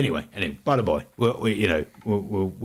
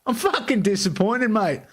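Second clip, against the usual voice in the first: high, hammed-up Australian accent, laughing through it; a bad Aussie mate scolding Timmy; pitched-up bad Australian accent; 10 semitones higher than usual. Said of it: "trying this Australian accent".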